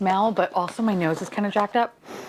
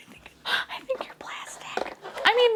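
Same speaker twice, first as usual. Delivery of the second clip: whispered aside; 3 dB quieter than usual. Real, not acted. Disappointed aside about plastic smell.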